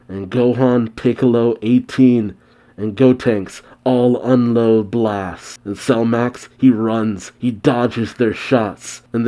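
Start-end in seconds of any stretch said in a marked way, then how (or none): none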